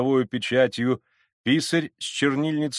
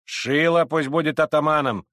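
raised, loud nomination; high, forceful call; crowd shouting; 5 semitones higher, 4 dB louder than usual. Acted. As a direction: shout your candidate to the crowd. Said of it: "кричали одни".

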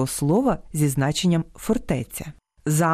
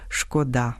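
шкодА́, final syllable stressed; stress on last syllable; 5 semitones lower, 3 dB quieter than usual. Shown as a demonstration. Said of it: "слід наголошувати це слово тільки". (наголос на останньому складі: шкода́)

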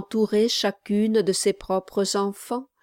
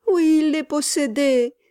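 crying out; 8 semitones higher, 6 dB louder than usual. A narrator's reading. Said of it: "s'écria la mère".

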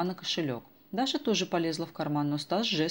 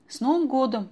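high, loud eager hello; boy eagerly hailing an old woman; eager greeting; 8 semitones higher, 8 dB louder than usual. A narrator's reading. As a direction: rush up with a bright greeting. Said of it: "бросился к ней Стас".

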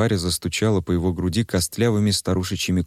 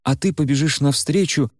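much higher, sly, eager order; Варя scheming; 7 semitones higher than usual. Acted. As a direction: relish the plan. Varya lays out plan. (высокий, азартный голос Вари)

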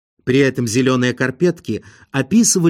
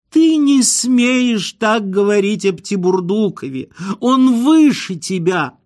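high-pitched indignant wail; wailing outburst; 8 semitones higher, 3 dB louder than usual. A narrator's reading. Wife suddenly defending her lover to her husband, wailing. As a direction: shriller drawn-out cry in a lover's defence. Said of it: "и вдруг заголосила".

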